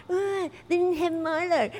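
high, mocking whine; a sulky customer whining to her friends; whinging. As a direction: moan it like a sulky complainer.

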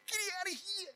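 high, soft child's voice, mock shout; a four-year-old's tiny shout; little boy's yell. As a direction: imitate a small boy yelling, quiet and high. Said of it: "And he goes".

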